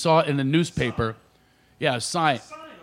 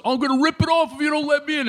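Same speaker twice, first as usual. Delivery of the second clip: mock threat; 10 semitones higher, faster than usual. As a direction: threaten the driver in a squeaky voice. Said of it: "he goes".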